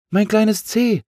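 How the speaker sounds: very high, excited call; exclaimed cry. A narrator's reading. Big A spots little C in a tree, exclaiming. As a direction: cry out on spotting a lost friend. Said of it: "rief das große A aus".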